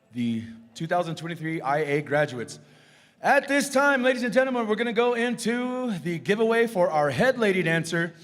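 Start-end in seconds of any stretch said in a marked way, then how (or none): 3.2-8.1 high, carrying announcer call; powwow emcee. Real, not acted. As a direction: announce it to the arena. Emcee introduces giveaway.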